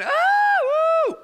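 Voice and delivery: high, loud mock kid scream; imitated screaming. Kids in the basement when the lights go out, mock screaming. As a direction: imitate panicked kids shrieking. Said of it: "the kids start screaming".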